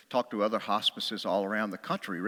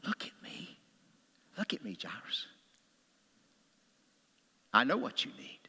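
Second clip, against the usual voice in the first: hushed, near-whispered tender reassurance; loving; 4 semitones higher, 8 dB quieter than usual. Acted. Jesus holding Jairus by the shoulders, lovingly reassuring. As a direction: softly reassure him, with long pauses between phrases. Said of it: "he just lovingly says".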